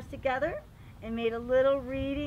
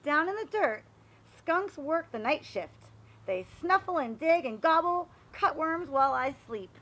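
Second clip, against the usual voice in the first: lively read-aloud voice, a bit higher; storybook narration; 5 semitones higher, 7 dB louder than usual. Acted. Teacher reading a garden book aloud to the class, storybook voice. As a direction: read it like a picture book for little kids. Reads picture book to children.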